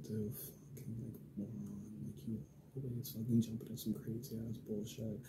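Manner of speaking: soft, hushed exasperated scolding; quiet scolding. Retold or imitated. Scolding her boyfriend after his jail fight, hushed and exasperated. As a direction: scold him under your breath.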